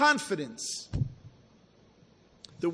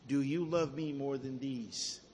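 soft, low, measured question; gentle question; 6 semitones lower, 8 dB quieter than usual. Retold or imitated. Jesus questioning Peter after his denial, soft and gentle. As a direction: ask it quietly and low, without pressing.

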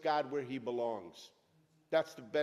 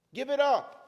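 loud, higher exhortation; forceful command; 7 semitones higher, 9 dB louder than usual. Acted. Preacher urging listeners to let go, loud command. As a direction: order them to drop it.

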